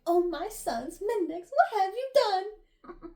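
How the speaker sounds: high, theatrical shock with flair; mock pearl-clutching. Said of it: "He probably clutched his pearls".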